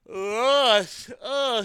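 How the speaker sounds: talking through a yawn; yawning mid-word. Real, not acted. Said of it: "sorry, I'm yawning".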